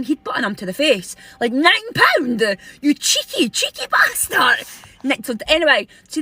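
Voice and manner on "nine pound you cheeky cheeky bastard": slow, high-pitched indignant telling-off; mock outrage. Customer confronting the man in the shop, mock outrage. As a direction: scold with drawn-out mock indignation.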